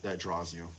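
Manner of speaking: usual speaking voice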